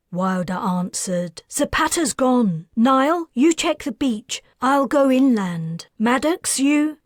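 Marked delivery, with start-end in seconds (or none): 1.5-7.0 higher, louder barked orders; urgent commands. A narrator's reading. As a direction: take charge and send them searching. Wilder gives urgent search orders.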